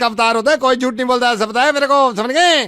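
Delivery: high, loud hammed-up insisting; mock insistence. Prank caller to mother, loud mock insistence. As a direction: push it hard like a fake-offended know-it-all. Host insists child never lies.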